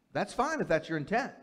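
ordinary talk

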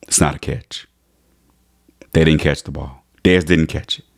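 low mock referee. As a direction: drop the voice like an official making the call. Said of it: "Referee comes up and says".

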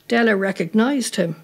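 plain reading voice

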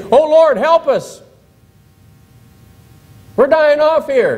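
high, pleading cry for help; desperate plea. Retold or imitated. Bitten people crying out to the Lord, pleading high. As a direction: beg the Lord as if dying.

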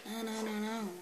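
normal talking voice

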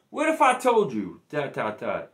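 a hint of a dramatic ESPN documentary narrator; ESPN style. Shown as a demonstration. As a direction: tease the reveal like a sports film trailer, trailing off. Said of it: "I'm gonna do this E S P N style".

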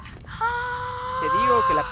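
squeaky high voice. Pretend.

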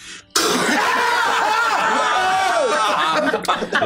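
squealing burst of laughter; high-pitched laugh. Real, not acted. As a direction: shriek with laughter. Laughing after stepping on twig.

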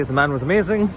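loud, high praise; excited. Real, not acted. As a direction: rave about him. Enthusing about taxi driver.

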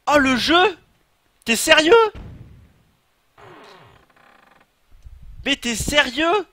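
loud, high and drawn out in disbelief; real frustrated shout; exasperated outcry. Real, not acted. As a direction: yell at the game, stretching each sérieux. Furious at game reset.

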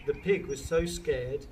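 ordinary delivery, nothing marked

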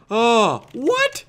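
very high, squeaked disbelief; astonished. Real, not acted. Player who did not expect the kill, astonished. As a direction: squeak it out in amazement. Stunned by his own kill.

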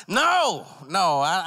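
loud, high-pitched no; emphatic refusal. Acted. Customer turns down weed on the ground, emphatic refusal. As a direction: cut him off high and loud.

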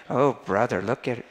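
acted self-righteous grumble; judgmental muttering. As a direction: mutter it in disapproval.

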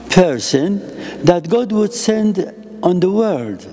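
ordinary talk, nothing marked

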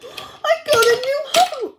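high, loud pretend delight; thrilled discovery. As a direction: play a pup bursting over a new gift. Pup discovers gift in pretend play.

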